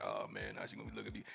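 low, soft embarrassed mumble to himself; self-conscious guy. Retold or imitated. Man worrying how his partner sees him, self-conscious. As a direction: quietly voice a worried man's inner thought. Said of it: "'cause they be like".

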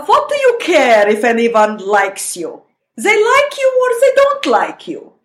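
put-on slow, drawn-out Russian-accented scolding, pitch swooping high; mother's Russian accent. Daughter giving another example of her mother, heavy Russian-accented disdain. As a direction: imitate a withering Russian mother, savoring each word. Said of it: "here's another one".

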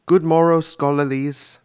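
hammed-up lecturer hello; mock professor greeting. A professor greeting his scholars, hammed-up. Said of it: "That's what I would say if I was a professor".

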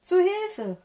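high, loud panicked shout; storybook alarm at full voice; cry for help. A narrator's reading. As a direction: yell out for help as a fleeing fox. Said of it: "rief er".

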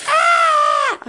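sharp, high startled yelp; startled shriek. Real, not acted. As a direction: jump at the noise and yelp. Pipes bang, sudden shriek.

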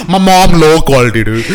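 loud, breaking up with laughter; laughing mock outburst. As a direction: crack up while blurting out the joke. Laughing at his own mock kid's complaint.

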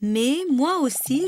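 ordinary talk, nothing marked

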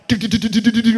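rising syllables for running; mock dribbling sounds. Player racing down the court, mimicked dribbling. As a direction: hum the dash down the court.

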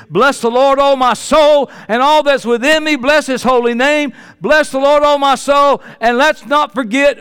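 loud psalm proclaimed high and strong; fervent preaching. Acted. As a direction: lift the scripture up like a shout of praise.

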